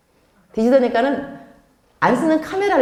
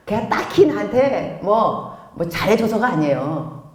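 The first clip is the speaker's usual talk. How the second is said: normal talking voice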